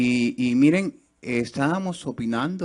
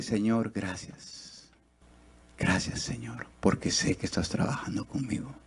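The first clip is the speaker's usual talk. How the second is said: hushed prayer; 7 dB quieter than usual. Retold or imitated. Retells his tearful prayer.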